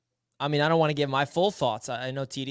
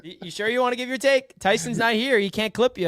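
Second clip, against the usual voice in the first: teasing; 8 semitones higher than usual. Real, not acted. Teasing co-host to speak.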